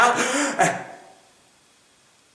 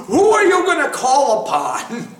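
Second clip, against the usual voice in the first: ordinary talk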